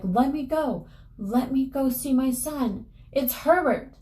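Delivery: high, insistent acted mother; desperate pleading. Mother fights to reach the door.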